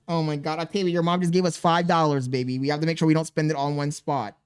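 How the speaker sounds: ordinary talk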